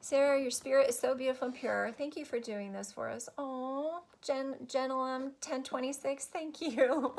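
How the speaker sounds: usual speaking voice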